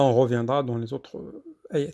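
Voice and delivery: ordinary delivery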